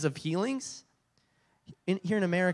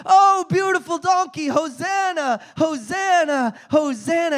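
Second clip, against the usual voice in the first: cheering shout; 12 semitones higher, 8 dB louder, slower than usual. Retold or imitated. Crowd cheering the donkey.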